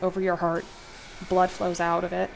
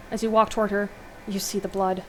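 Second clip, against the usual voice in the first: usual speaking voice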